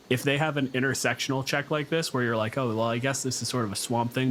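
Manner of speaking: ordinary talk, nothing marked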